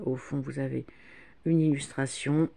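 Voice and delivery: ordinary delivery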